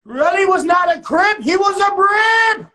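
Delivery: loud and high, stretching out Brip; shouted retelling. A narrator's reading. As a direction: yell it out to set it straight. Repeats the line, now yelled.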